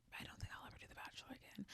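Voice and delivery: hushed, quick aside; a whispered admission she is done. Real, not acted. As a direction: whisper it like a confession. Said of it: "whispered aside".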